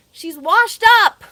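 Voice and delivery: high, loud and slow, dragging it out; loud emphatic verdict. Real, not acted. To her viewers about Erica, a loud slow verdict. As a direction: declare it loudly so it lands.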